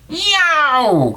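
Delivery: high, loud yelp; yelp of pain. A narrator's reading. Wolf landing in the fire, high loud yelp. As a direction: yelp as if burned. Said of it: "cried the wolf".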